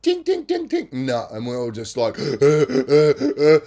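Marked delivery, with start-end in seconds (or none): 0.0-0.9 high, light tapping sound on glass; mock high tinkling taps; tapping noise. Mimics tapping again. 2.2-3.6 breathless, stuck, terrified uh sounds; mock terrified gasping; frightened stammering gasps. Shown as a demonstration. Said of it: "like this".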